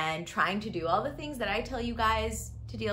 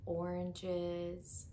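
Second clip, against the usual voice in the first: soft, hushed meditation voice with long pauses; guided meditation; 10 dB quieter than usual. Acted. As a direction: gently guide listeners into calm, leaving space for each breath.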